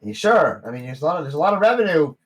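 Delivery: high, loud eager salesman gnome; gnome barkeep. Acted. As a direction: push the sale hopefully.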